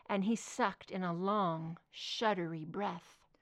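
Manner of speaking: ordinary delivery, nothing marked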